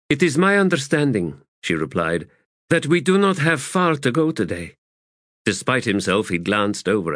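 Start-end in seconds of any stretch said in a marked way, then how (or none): 0.1-4.8 a hint of a cool female officer; higher, even and composed; Faradan Sort, measured. Fist informing her lieutenant, lightly voiced and cool.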